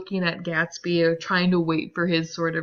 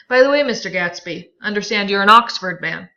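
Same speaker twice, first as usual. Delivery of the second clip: a louder, pointed jab; Tom needling Gatsby; 9 dB louder than usual. Acted. Teacher reads Tom needling Gatsby, pointed and loud. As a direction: lean on him, sly and loud.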